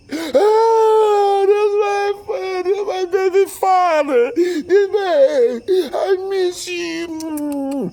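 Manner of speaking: very high, loud mock-tearful squeal; hammed-up impression of an overjoyed lover, slow and wailing. Mistress overjoyed hearing from him.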